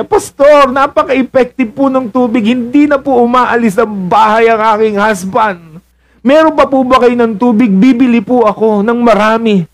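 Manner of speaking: gushing wife, high and eager; high-pitched wife voice. Acted.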